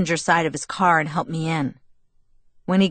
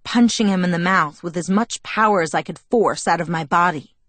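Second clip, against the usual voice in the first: ordinary delivery, nothing marked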